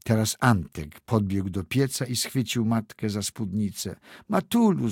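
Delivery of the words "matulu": high, tearful call to mother; crying call. A narrator's reading. Boy cries out to his mother, high and tearful. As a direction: call out much higher, through tears. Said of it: "zawołał z płaczem".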